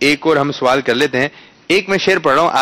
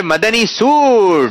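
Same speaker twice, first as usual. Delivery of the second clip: stretched-out, swelling showman's call; prize announcement; 7 semitones higher, 3 dB louder, much slower than usual. Real, not acted. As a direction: announce the gift with a big drawn-out finish.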